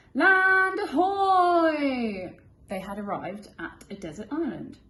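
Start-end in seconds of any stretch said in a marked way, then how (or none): 0.1-2.4 loud, higher, very slow call; shouting lookout. Acted. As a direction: call out from up high, drawn out. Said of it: "a voice from the crow's nest shouted".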